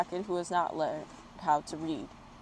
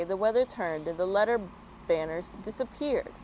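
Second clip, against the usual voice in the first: usual speaking voice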